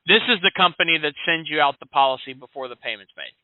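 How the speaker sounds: ordinary talk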